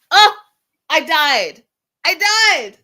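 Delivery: high, loud delight; excited laughing. Real, not acted. Host recalling the ear grab, excited delight. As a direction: squeal it out, delighted. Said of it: "laughing at the memory".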